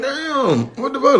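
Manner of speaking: high, startled outburst; shocked reaction. Retold or imitated. Retelling the manager's first call, high and startled. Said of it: "I was like".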